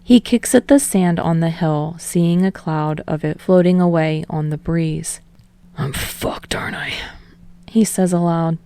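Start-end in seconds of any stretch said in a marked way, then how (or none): 5.8-6.9 hushed unvoiced whisper, much softer; whispered to himself. Bakugo alone on the hill, whispering to himself. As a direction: breathe it out under your breath.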